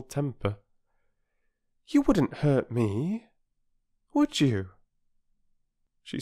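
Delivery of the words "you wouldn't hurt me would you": put-on high, slow, coaxing girl's question; gentle girl. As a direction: coax a stranger like a wary dog. Bobby voiced, slow.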